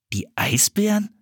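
can't believe what he just heard; a boy repeating the word in disbelief; incredulous. A narrator's reading. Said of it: "wiederholte Philipp ungläubig".

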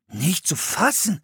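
jumping up in wonder; excited exclamation. A narrator's reading. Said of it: "rief Philipp". (staunender Ausruf)

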